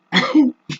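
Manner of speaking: sudden high laugh; high burst of laughter. Real, not acted. Laughs at calling herself stupid.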